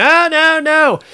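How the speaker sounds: a mild mock scream up high; retold scream. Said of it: "she's screaming".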